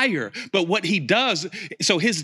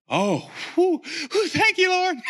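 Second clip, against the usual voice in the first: mock relief; 14 semitones higher than usual. Relieved after Jesus' prayer.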